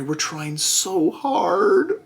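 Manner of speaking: mocking the company's excuse in a put-on voice; sarcastic company voice. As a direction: drag it out in a fake sweet whine. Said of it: "so now they're like".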